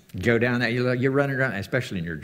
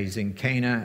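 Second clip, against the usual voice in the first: ordinary talk, nothing marked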